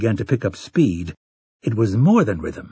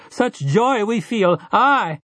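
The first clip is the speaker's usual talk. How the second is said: much higher calling voice; woman calling out; 10 semitones higher, slower than usual. Acted. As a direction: call across the crowd to the gunslinger. Woman continues.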